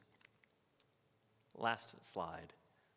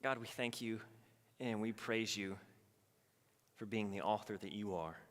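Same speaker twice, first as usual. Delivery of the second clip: closing prayer. Acted.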